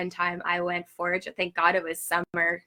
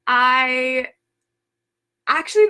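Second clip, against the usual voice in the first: usual speaking voice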